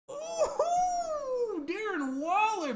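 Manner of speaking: very high, long falsetto whoop; whooping fan cheer; celebratory whoop. Real, not acted. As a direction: stretch the player's name out high in celebration. Celebrating Waller's catch.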